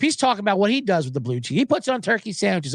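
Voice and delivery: normal talking voice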